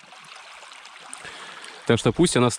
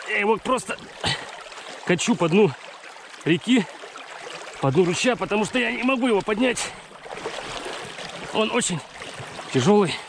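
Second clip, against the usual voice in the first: straining with effort; 8 semitones higher, 4 dB quieter than usual. Real, not acted. Rolling heavy rock along stream.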